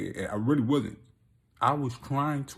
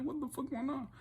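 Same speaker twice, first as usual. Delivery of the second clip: hushed and pitched up high; a baffled half-whisper, pitched up; whispering in confusion; high hushed disbelief; 8 dB quieter than usual. Retold or imitated. Retold confusion.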